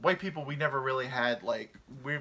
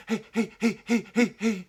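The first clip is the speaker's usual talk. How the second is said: high, loud, frantic dog greeting; an overexcited dog barking hellos; hyper dog; 9 semitones higher, 8 dB louder than usual. Acted. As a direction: yap it out like a wired dog.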